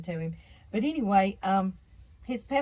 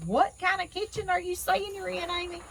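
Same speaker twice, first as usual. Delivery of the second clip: high, puzzled voice of a confused viewer; 11 semitones higher than usual. Retold or imitated. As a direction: mimic a baffled outsider asking, pitched up. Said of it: "some of y'all can't even understand".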